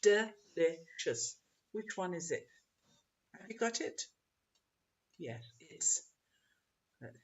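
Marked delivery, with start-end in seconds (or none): 0.0-1.3 'Delicious' slowly separated, de-li-cious; syllable by syllable. Shown as a demonstration. Teacher asking which is the biggest sound, syllable by syllable. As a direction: give 'Delicious' three slow, spaced beats. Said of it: "Which one is the one with the stress".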